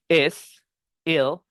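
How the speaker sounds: ordinary talk, nothing marked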